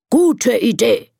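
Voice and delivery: slow lion voice, mildly roared; roaring lion. Acted. Lion approving the monkey's idea, slow mild roar. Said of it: "brüllt der Löwe".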